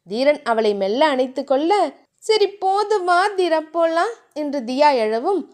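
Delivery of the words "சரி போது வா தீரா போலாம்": put-on higher, bashful girlish voice; flustered young wife; shy heroine. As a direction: brush him off shyly, voice lifted.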